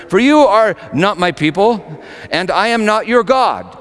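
high, loud pronouncement; stern divine decree. Acted. Preacher voicing God's rejection of Israel, stern. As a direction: thunder the Lord's rejection.

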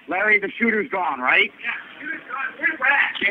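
calling out. Real, not acted. Calling to others in the cafe.

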